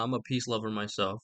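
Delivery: ordinary talk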